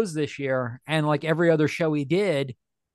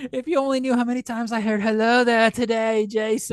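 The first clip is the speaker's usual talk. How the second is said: high, loud put-on British star voice; Ewan McGregor; 6 semitones higher, 3 dB louder than usual. Celebrity to Jason about the catchphrase, mock impression. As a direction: play a fed-up celebrity, pitched up. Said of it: "when Ewan McGregor said".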